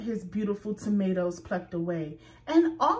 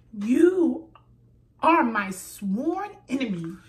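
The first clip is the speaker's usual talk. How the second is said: loud, punchy declaration; angry vow; 3 semitones higher, 6 dB louder than usual. A narrator's reading. Rabbit declaring Squirrel her enemy, loud and angry. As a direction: spit out the vow at him. Rabbit pushes back angrily.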